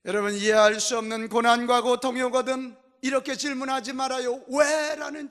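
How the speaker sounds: loud, urgent sermon exhortation; full-force preaching. Real, not acted.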